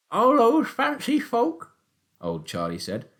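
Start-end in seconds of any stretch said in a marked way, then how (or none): 0.1-1.8 put-on high, loud old countryman's voice; sly old taxi driver. A narrator's reading. Old Charlie teasing Willum about the shoot, high and loud. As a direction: play the crafty old poacher, much higher and louder.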